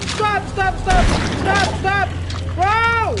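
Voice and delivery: frantic high yelling in panic; angry crying. Real, not acted. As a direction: yell in terrified frustration. Panicking while playing scary game.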